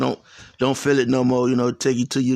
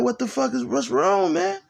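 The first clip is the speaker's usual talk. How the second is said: high startled mock alarm, much higher; pitched-up imitated alarm; alarmed question; 12 semitones higher than usual. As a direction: retell the kids' sudden panic. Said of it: "And we like".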